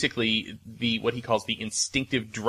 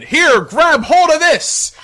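high, loud, slow call; 11 dB louder, slower than usual. Acted. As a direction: shout it across the water. Rescuer calling to swimmer.

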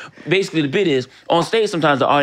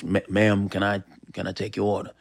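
a lower, softer, meek cashier voice, quickening at the end; timid fast-food cashier; 6 semitones lower, 7 dB quieter than usual. Retold or imitated. Imagining Denzel working a McDonald's counter, meek soft cashier voice. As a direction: play Denzel as a shy counter worker taking an order. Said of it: "if he worked at a, um, a McDonald's".

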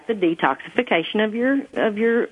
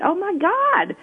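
higher, lightly acted overwhelmed cry; mock-tearful realisation, raised pitch; retold tearful amazement; 9 semitones higher than usual. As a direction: quote your own astonished reaction, pitched up. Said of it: "I just started crying".

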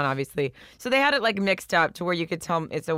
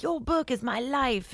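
put-on English accent; 9 semitones higher, 4 dB quieter, slower than usual. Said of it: "I love your English accent".

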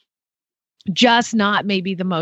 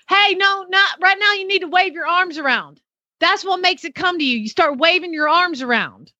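imitating a know-it-all owner, much higher pitch; bossy correcting; 8 semitones higher than usual. Owner telling trainer how to call the horse, bossy correcting. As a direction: cut in with high-pitched mock instruction.

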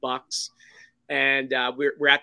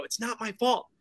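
mock protest; 7 semitones higher, 3 dB quieter than usual. Storyteller to doubters who warn of trouble, mock protest. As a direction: protest your innocence in a higher voice. Answering people who accuse him.

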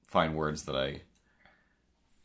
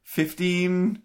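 flustered; 8 semitones higher, 6 dB louder, slower than usual. Real, not acted. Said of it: "Just do it".

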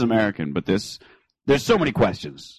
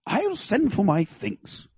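mock posh British; 7 semitones higher, 4 dB quieter, much slower than usual.

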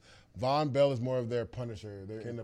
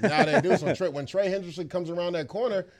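raised, louder, fired up; excited; 8 semitones higher, 8 dB louder than usual. Real, not acted. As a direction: talk it up with energy. Excited point echoing cohost.

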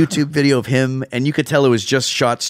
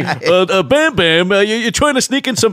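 much higher bumbling cartoon voice; flustered bumbling goofy; 10 semitones higher, 3 dB louder than usual. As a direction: stumble over the words goofily. Cartoon guard scene.

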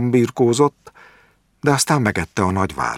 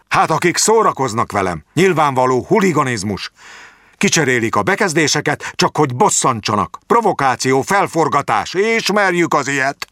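put-on higher, agitated old man's voice; a lightly voiced suspicious old man, worked up; paranoid rant; 7 semitones higher than usual. A narrator's reading. Jáki ranting about provocateurs to the doctor.

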